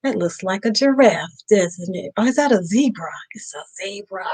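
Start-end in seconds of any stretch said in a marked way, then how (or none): 3.3-4.2 whispered answer to a riddle. Acted. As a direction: let the answer slip out in a whisper. Teacher answers own question to kids.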